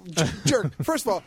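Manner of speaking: ordinary talk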